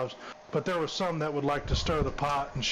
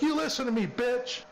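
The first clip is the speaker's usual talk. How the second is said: retold yell; 9 semitones higher than usual. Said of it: "She, like, screamed".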